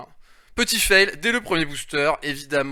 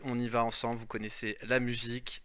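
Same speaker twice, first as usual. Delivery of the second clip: usual speaking voice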